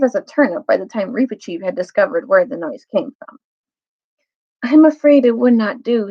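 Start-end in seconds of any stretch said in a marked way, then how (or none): 4.6-6.1 gravely. Acted. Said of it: "said Peter very gravely".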